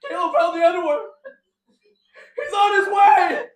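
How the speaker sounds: mock panicked shout; frantic warning. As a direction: yell the warning like the sky is falling. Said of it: "He's like".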